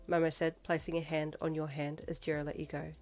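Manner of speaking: usual speaking voice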